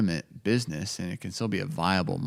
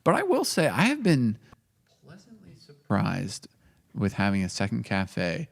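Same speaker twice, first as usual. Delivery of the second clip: normal talking voice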